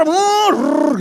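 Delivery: short high loud grumble noise; mock angry growl. Imagined angry dentist growling, mock grumble. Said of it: "Jangan dia marah".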